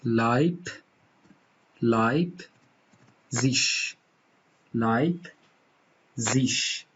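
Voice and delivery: لایپ، زیش with the final ig said as a light ish; German pronunciation. Shown as a demonstration. Teacher breaks a German word into syllables. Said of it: "مثلاً این کلمه".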